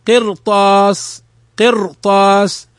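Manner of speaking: slow قرطاس with a thick r and long vowel; heavy ra. Shown as a demonstration. Teacher repeats قرطاس for students, slow heavy ra. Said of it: "فإنها تفخم كذلك".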